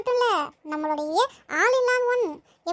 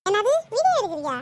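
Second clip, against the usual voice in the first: angry mother voice. Acted. Mother to Mouli, mock threatening over her serial. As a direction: threaten the kid with mock fury.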